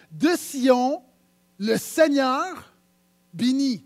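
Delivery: loud, drawn-out verse reading; declaimed scripture. Acted. Preacher reading the prophet Amos to the church, declaimed. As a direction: proclaim the verse slowly and loud.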